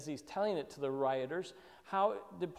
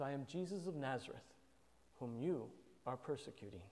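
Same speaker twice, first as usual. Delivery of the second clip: much softer, gentle and lowly; humble and soft; 3 semitones lower, 9 dB quieter than usual. Retold or imitated. Preacher voicing Jesus answering Paul, soft and humble. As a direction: say it meekly, drawing him in. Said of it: "He just says".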